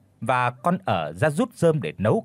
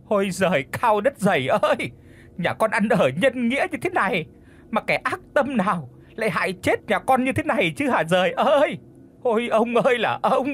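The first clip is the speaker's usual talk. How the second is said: widow's wailing lament; 7 semitones higher than usual. A narrator's reading. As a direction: cry out to heaven in grief. Widow wailing over her burnt husband.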